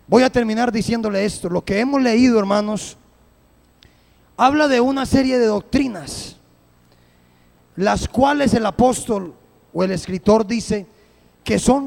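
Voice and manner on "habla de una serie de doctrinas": ordinary talk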